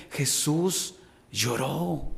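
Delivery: lento y más suave, con peso; tristeza solemne. Acted. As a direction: deja caer las dos palabras despacio. Pastor lee que Jesús lloró. (lento y suave, con tristeza contenida)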